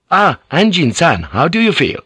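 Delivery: high, pleased officer's hello; delighted greeting. A narrator's reading. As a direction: greet him eagerly, pitch lifted. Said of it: "his eyes lit up".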